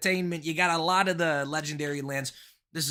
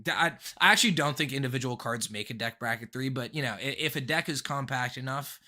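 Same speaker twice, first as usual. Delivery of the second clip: ordinary talk, nothing marked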